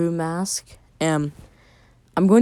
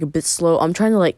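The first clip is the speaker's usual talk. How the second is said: usual speaking voice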